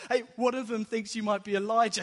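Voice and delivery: loud, high, hammed-up banter; joking chip-in. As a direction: chip in over the laughter with a mock-amused shout. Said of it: "everyone starts laughing".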